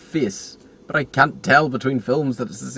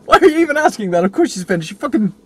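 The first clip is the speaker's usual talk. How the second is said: incredulous outburst; 11 semitones higher, 7 dB louder than usual. Retold or imitated. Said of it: "It's just like".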